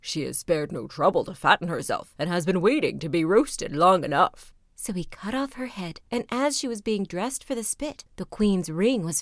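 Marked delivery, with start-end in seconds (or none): 0.0-4.5 lower, louder, hearty cook's voice; a cook sizing up his roast. A narrator's reading. Cook to the servant, a low hearty voice.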